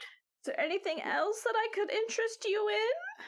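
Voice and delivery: put-on high old granny voice; old lady voice. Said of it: "old lady voice".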